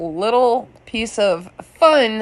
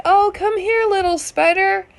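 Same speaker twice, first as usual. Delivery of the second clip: much higher, coaxing voice for a spider; sweet-talking neighbor; 11 semitones higher than usual. Retold or imitated. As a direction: coax it like a pet, way up high. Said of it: "he's like".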